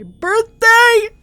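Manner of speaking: loud upset protest; tearful shout. Acted. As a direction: cry out against it. Distraught over his death.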